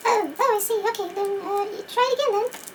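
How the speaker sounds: high squeaky cat voice, patient; the toy cat way up high, telling the doctor to retry; Mister Cat. Pretend. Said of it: "Mister Cat".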